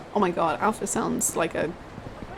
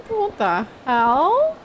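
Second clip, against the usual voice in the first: incredulous; 4 semitones higher, 3 dB louder, much slower than usual. Real, not acted. Reacting in disbelief to the game.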